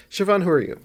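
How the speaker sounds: ordinary talk, nothing marked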